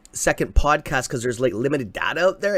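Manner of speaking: normal talking voice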